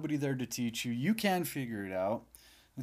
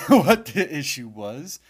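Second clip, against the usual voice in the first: louder, laughing through the words; 2 semitones higher, 10 dB louder, slower than usual. Real, not acted. Laughing about unknown fix.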